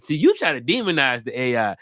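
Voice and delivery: usual speaking voice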